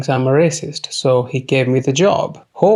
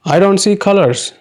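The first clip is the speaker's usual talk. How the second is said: high, louder mock sincerity; 6 semitones higher, 3 dB louder than usual. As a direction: parrot the smug colorblind line. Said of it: "what an inclusive racist would say".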